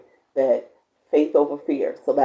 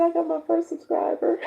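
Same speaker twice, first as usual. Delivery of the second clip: high, excited retelling with laughter; delighted mock squeal of the news; excited echo; 14 semitones higher, 5 dB louder than usual. Real, not acted. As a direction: squeal her news back. Echoing friend's joy.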